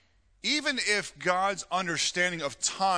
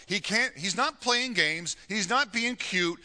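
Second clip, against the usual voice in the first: normal talking voice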